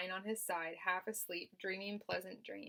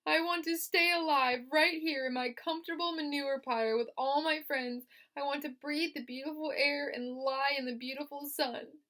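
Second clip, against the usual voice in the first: mild tearful pleading; 7 semitones higher, 7 dB louder than usual. Acted. Wilbur in tears.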